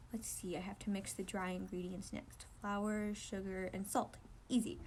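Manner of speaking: unvoiced whisper, reading the recipe; whispered to himself. Okawa whispering the recipe to himself, hushed. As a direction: murmur it under your breath.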